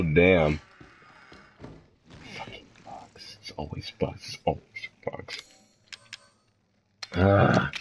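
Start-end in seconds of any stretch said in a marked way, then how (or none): 2.4-5.4 whispered under the breath, much softer; whispering curses at the bugs; frustrated whisper. Real, not acted. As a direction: hiss it to yourself in disgust. Frustrated after bug attack.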